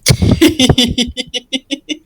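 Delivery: light breathy giggle; a quick amused giggle. Acted. Gil giggling at the wet pumpkin spirit.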